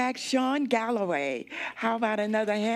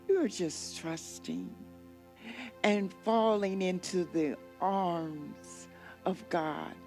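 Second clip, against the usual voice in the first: guided meditation; 5 dB quieter than usual. Acted. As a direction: lull them gently down the river.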